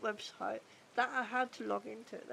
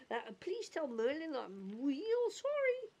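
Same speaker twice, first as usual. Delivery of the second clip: slow, higher cartoon voice; goofy voice slipping upward into a cartoon mouse; Mickey; 4 semitones higher than usual. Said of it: "that ended up being Mickey".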